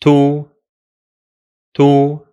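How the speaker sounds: "to" as one short syllable; syllable drill; syllable demo. Shown as a demonstration. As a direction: say "to" briefly, twice. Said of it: "so we add".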